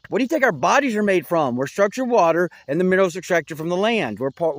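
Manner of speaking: normal talking voice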